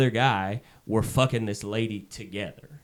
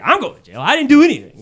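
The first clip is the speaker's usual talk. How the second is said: high and loud, can't believe it; rising to an indignant yelp; incredulous outburst; 11 semitones higher, 10 dB louder, faster than usual. Retold or imitated. Narrator protesting to the drunk guy, high and indignant. Arguing back about going to jail.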